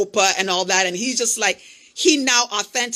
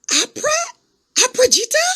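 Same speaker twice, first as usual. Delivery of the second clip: put-on thrilled to spot an old friend; excited recognition. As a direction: call her name in joyful disbelief.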